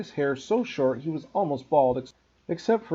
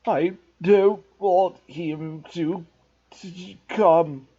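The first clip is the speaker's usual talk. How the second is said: slow, loud whiny blubbering; fake sobbing yell; 4 semitones higher, 5 dB louder, much slower than usual. A narrator's reading. Spoiled boy fakes sobs to his mother, whining. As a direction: put on crocodile tears, wailing. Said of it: "Dudley yelled, between huge pretend sobs".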